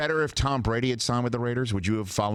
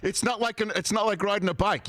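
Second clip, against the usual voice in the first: high mock voice; 12 semitones higher than usual. Host mimics a high voice.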